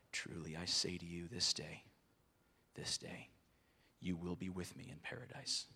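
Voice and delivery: soft, near-whispered promise; a hushed Jesus comforting the thief; 1 tender promise. Acted. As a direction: lay it down gently, almost a whisper.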